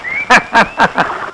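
quiet breathy villain laugh; soft gloating laugh. Acted. Father gloating alone over the plan, soft laugh. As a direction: laugh under your breath, pleased.

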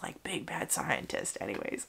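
hushed, dramatic whisper. Real, not acted. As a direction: whisper it like a spooky secret. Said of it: "I don't know why I'm talking like this".

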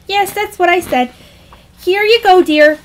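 high, loud, lilting mom voice; high-pitched dismissive mother. Acted. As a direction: breezily hand over the phone.

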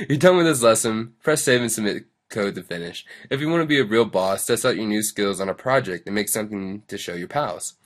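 loud, peppy tutorial read; lesson read-aloud. Acted. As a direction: read the instructions out with big lively emphasis. Reads the closing text aloud.

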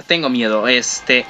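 ordinary talk

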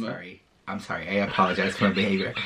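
low, soft mock contrition; sheepish apology. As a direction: back down quickly in a small, low voice. Mock apology after playful scolding.

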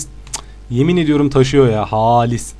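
usual speaking voice

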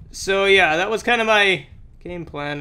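ordinary delivery

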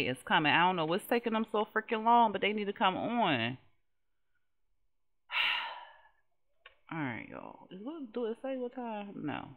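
ordinary delivery

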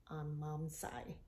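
ordinary delivery

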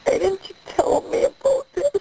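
tearful, high, voice breaking; crying. Real, not acted. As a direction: sob out the question in real distress. Mother hears son's confession.

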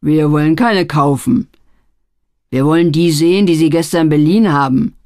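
loud menacing demand; threatening. A narrator's reading. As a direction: lean on him, threatening. Barry threatening pawnbroker.

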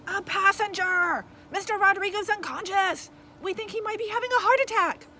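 high, loud urgent report; panicked shouting. A narrator's reading. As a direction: shout the emergency into the microphone. Passenger urgently reporting emergency to dispatcher.

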